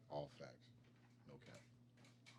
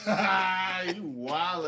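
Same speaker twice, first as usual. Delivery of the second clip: loud laughing call-out; laughing shout; 7 semitones higher, 14 dB louder, much slower than usual. Real, not acted. Friend reacts to confession.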